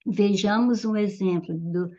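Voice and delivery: ordinary delivery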